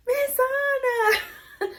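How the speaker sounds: high, excited cry of a name; excited kids. Retold or imitated. Kids spotting their old bus driver, high and thrilled. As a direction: imitate the kids' delighted shout. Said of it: "They were like".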